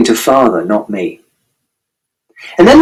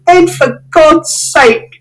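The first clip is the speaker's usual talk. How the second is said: very high, slow cry; exasperated shout; much slower than usual. A narrator's reading. Mother shouting at father in exasperation. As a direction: at the end of your tether. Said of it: "And Mother shouted".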